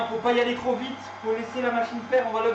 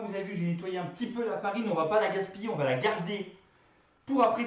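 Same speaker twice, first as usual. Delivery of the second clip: normal talking voice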